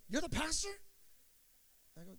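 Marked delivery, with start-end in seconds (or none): 0.1-0.8 high, rising disbelief; a lightly voiced old man, taken aback; imitating surprise. Veteran surprised to learn he's talking to the pastor, high and rising. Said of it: "He goes".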